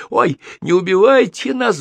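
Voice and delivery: high, squealed plea for mercy; panicked squealing. A narrator's reading. Two soldiers begging the armed captain, squealing in panic. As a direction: squeal in comic terror. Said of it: "в голос заверещали Зюзик и Рябушкин".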